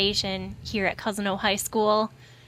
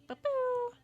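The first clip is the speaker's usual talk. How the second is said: silly jingle; 9 dB quieter than usual. Pretend. Playful sound effect on air.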